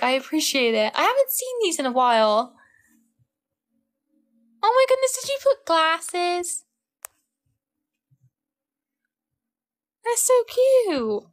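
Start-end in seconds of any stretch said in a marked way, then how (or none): 4.6-11.2 very high, slow gushing squeal; real delighted squeal, much higher. Real, not acted. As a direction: squeal over the cute glasses. Surprised by gift prank on stream.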